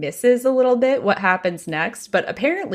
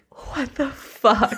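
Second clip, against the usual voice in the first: stunned disbelief; 5 semitones higher, much slower than usual. Retold or imitated. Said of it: "Everyone is like".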